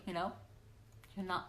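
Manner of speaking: normal talking voice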